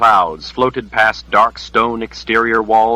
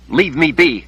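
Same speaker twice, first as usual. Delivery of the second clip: higher-pitched, irritated retort; annoyed snap; 7 semitones higher than usual. A narrator's reading. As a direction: snap at a voice that keeps pestering. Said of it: "she snapped, annoyed".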